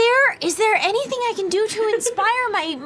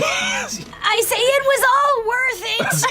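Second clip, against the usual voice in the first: drawn-out, raised dying declaration; dramatic last words; 5 semitones higher, much slower than usual. Acted. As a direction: cry it out slowly, overacted. Heroic last words as poison hits.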